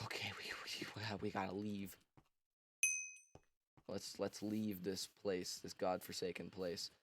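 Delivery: a mock secretive hush. Fugitives hiding planning escape.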